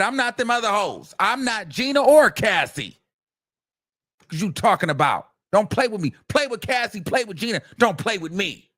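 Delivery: high, sassy and defiant; sassy diva. Retold or imitated. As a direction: toss her head at the rivals. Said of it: "She said".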